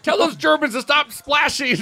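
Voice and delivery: high angry shout. Pretend. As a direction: shout it over the water. Mock rowing shout.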